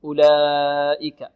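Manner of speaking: slow أولئك with a drawn-out aa; four-count madd. Shown as a demonstration. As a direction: stretch the laa of أولئك long before the hamza. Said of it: "بمقدار أربع حركات". (أولئك بمد متصل أربع حركات)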